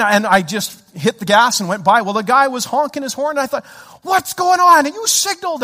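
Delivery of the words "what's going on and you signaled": high, agitated protest; indignant outburst. Retold or imitated. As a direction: complain loudly at the other driver. Acted indignation at other driver.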